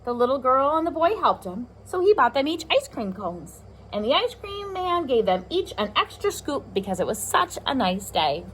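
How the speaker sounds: usual speaking voice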